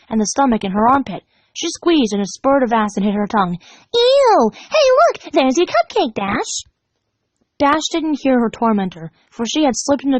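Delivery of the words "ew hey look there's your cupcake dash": squealing. Acted. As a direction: squeal then show it off. Pinkie squealing.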